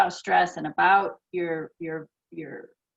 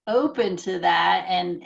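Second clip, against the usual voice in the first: ordinary talk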